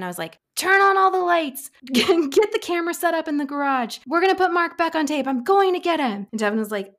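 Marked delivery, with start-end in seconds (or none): 0.6-6.3 high, loud yell across the house; yelled orders. Retold or imitated. Casting director yells instructions to Devin before leaving, urgent high shout. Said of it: "I yelled to Devin".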